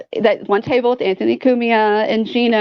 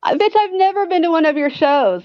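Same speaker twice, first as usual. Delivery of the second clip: higher-pitched, indignant retort; indignant comeback; 7 semitones higher than usual. Retold or imitated. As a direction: fire back at the snub, offended. Imagined angry comeback to Chrissy.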